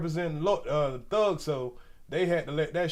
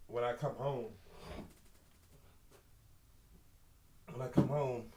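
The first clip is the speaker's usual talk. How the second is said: stuffy nose; 5 semitones lower, 7 dB quieter than usual. Real, not acted. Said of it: "excuse me if I sound a little stuffy".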